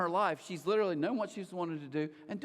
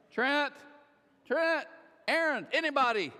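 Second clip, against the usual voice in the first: high, loud mock summons; imitated urgent shout for backup; calling for help; 8 semitones higher, 5 dB louder than usual. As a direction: call out for someone to take over. Wife calling others for help.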